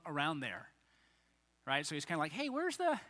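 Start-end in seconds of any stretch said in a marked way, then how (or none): 2.3-3.0 casual higher ask. Retold or imitated. Jesus asking casually, higher.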